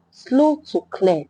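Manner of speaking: usual speaking voice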